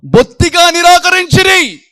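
shouted sermon climax; loud preaching. Acted. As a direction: thunder the verdict at the congregation. Preacher thunders the verse.

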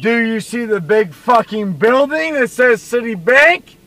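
loud exasperated yelling; shouting. Real, not acted. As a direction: yell it in frustration.

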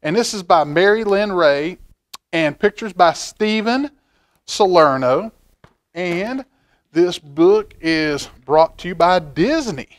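ordinary delivery, nothing marked

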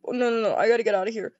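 usual speaking voice

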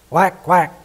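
mother duck calling quack quack to her ducklings; called quack. Acted. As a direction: call the brood with a quick quack. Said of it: "cried she".

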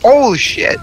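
shocked yelp. Real, not acted. Nearly killed himself with grenade.

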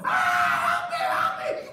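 loud staged cry for help; scream, then call out frantically. Acted. Wife grabs Joseph and screams for help.

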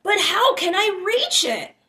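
a lost calf reaching for its mother; little one calling. A narrator's reading. Child calls up to the moon, high and longing. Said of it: "little one said".

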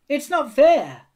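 high, loud, drawn-out complaint; indignant, slow yell; shouted protest. A narrator's reading. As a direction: protest like aggrieved children. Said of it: "the spiders on Scrabble's team shouted".

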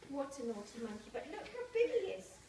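usual speaking voice

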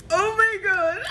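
high squeal breaking into laughter; overwhelmed. Real, not acted. Fan overwhelmed by her film strip, giddy high squeal. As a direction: squeal it, close to happy tears.

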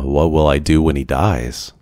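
plain reading voice

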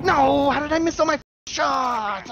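loud frustrated outburst; frustrated yell. Real, not acted. Missing shots in game.

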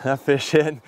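ordinary talk, nothing marked

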